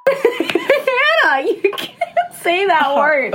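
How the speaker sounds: high, slow laughing scold. Real, not acted. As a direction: scold her while cracking up. Shocked laughing scolding friend.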